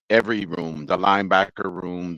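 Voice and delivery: normal talking voice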